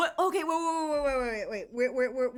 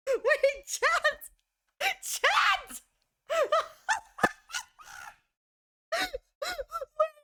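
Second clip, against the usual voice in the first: excited squeal; 13 semitones higher, much slower than usual. Real, not acted. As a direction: shriek it to chat in disbelief. Streamer laughing in disbelief.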